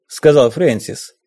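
usual speaking voice

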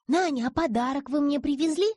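put-on high loud hopeful child. A narrator's reading. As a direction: ask eagerly like a little girl. Girl asks for present. (детский голос)

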